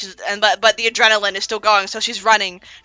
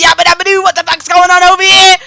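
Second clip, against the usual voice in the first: higher, louder startled yell; mock shout; 8 semitones higher, 10 dB louder than usual. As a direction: yell across the factory floor. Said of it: "They're like".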